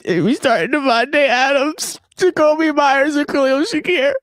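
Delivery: high-pitched, put-on tearful voice; method-acted whimper; fake crying. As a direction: act on the verge of tears, drawn out. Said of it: "I'm just acting into it".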